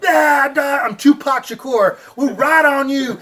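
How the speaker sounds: playful battle cry at the cats; mock war cry. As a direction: bellow it like storming in. Charging cats, yelling war cry.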